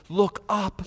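soft, hushed look up; voicing God, hushed; quiet call. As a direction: coax them gently to look up.

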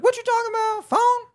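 put-on very high, loud old-lady squawk; imitated old woman. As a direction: screech it like a baffled old lady. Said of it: "a old woman. She's like".

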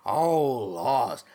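a light Southern drawl on Oh, Lord, imitating Tom Cullen; lightly mimicking a gentle Southern man; Southern accent. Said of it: "so he's got the accent".

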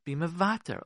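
ordinary talk, nothing marked

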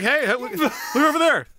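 very high, excited stagey voice; hammed-up calling out. Plotters staging Rasputin's return for witnesses, hammed-up high calls. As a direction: call out like a bad actor spotting someone.

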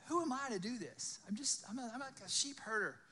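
freaking out. Acted. Moses doubting himself before God, acted mild panic. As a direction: protest as if a little overwhelmed. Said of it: "Moses is freaking out".

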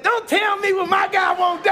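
high, loud joyful shout; triumphant shout. Retold or imitated. After ten thousand check.

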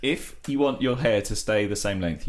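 ordinary talk, nothing marked

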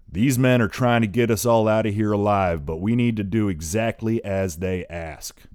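loud booming call. A narrator's reading. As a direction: boom it out to the cliffs. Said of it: "throwing his booming, gravelly voice".

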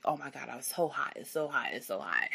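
softly moaning about the heat; drained, quiet, low complaint; faint, weary complaint. Retold or imitated. Retelling overheating under epidural.

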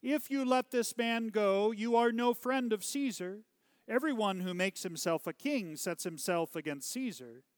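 high, loud protest, easing toward the end; crying out. A narrator's reading. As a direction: cry out a warning to the governor.

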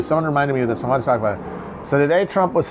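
normal talking voice

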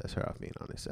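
whispered, no voice at all; whispering. Shown as a demonstration. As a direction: breathe the words without voice. Same line repeated in whisper.

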